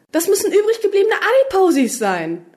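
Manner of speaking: high-pitched excited deduction; enthusiastic. A narrator's reading. Doctor explains his sudden brainwave, enthusiastic. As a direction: announce the discovery in a high, thrilled voice. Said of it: "rief er begeistert aus".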